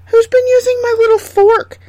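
very high, loud tiny dwarf voice; squeaky dwarf. A narrator's reading. Fifth dwarf misses his fork, very high loud squeaky voice. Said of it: "said the fifth".

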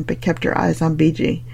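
usual speaking voice

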